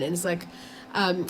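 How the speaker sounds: normal talking voice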